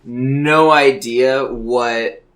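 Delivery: ordinary talk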